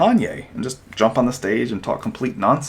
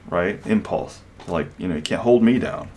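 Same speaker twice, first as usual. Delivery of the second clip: ordinary talk, nothing marked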